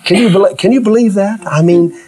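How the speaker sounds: usual speaking voice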